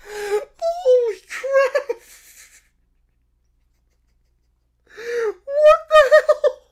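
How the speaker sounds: slow, high, laughing exclamation; shocked laughing outcry; laughing disbelief. Real, not acted. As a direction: stretch it out while laughing. Reacting to bear's story.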